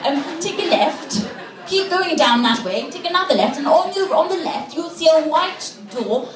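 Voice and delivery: rambling, over-polite posh British; snotty British guy. Acted. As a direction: dither like a snooty English gentleman. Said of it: "snotty-nosed British guy who would go".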